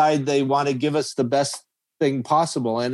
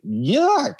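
high, squealed yuck of disgust; 10 semitones higher than usual. Pretend. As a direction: recoil in disgust. Earth voiced saying yuck.

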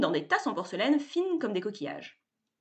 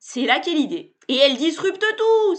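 higher and louder triumphant cry; exclaiming; 8 semitones higher, 9 dB louder than usual. A narrator's reading. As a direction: proclaim it like a startup guru. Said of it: "s'exclame Sébastien".

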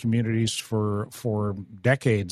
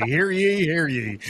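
town crier; 3 semitones higher, slower than usual. Pretend. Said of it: "That's how I like to start the show".

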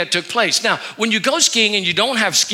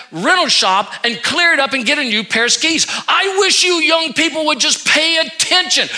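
raised, higher, heated telling-off; scolding lecture; 6 semitones higher than usual. Retold or imitated. Pastor scolding a teen about the skis, heated lecture. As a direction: lay into the kid.

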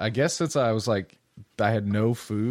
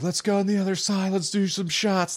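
high-pitched, excited party holler; rally the group, mimicking your drunk self; retold drunken rallying; 11 semitones higher than usual. Drunk guy urging friends to another round, imitated excited call.

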